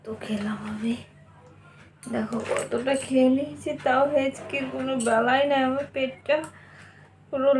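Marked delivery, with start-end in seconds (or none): 0.0-2.4 whisper. Real, not acted. Aside after eating.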